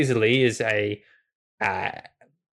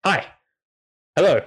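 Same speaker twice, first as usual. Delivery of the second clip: eager dog; 7 semitones higher, 4 dB louder than usual. Acted. Excited dog greets party.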